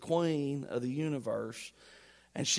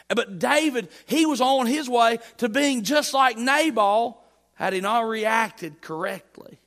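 louder and higher, building to a shout; rising preaching shout; 7 semitones higher, 6 dB louder than usual. Real, not acted. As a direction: drive it home at full force. Preacher climbing in force.

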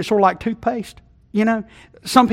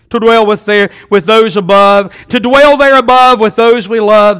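louder, slow, sing-song rhyme; lofty recitation; 3 semitones higher, 10 dB louder, slower than usual. Acted. Preacher reciting the heavenly rhyme to the congregation, loud and slow.

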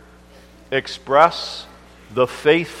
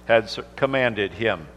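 measured, solemn Bible reading; unhurried King James reading; scripture reading; 4 semitones lower, 5 dB quieter than usual. Acted. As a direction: read the holy word from the pulpit. Minister reads scripture aloud.

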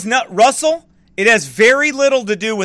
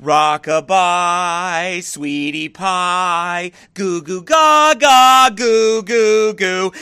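put-on slow, cooing baby talk, lower; mock lullaby baby talk; 4 semitones lower, much slower than usual. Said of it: "you know what people want you to do?".

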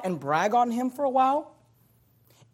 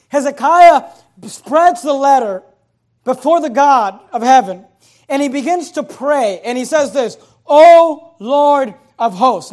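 loud pulpit preaching, key words punched; forceful preaching; 5 semitones higher, 11 dB louder than usual. Acted. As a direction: preach it out to the whole church. Preaches with force on Hezekiah's prayer.

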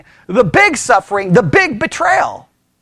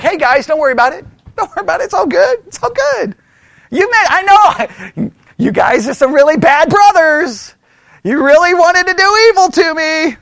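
carefree Joseph; 5 semitones higher than usual. Acted. Preacher acts carefree Joseph to brothers.

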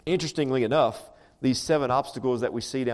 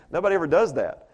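ordinary talk, nothing marked

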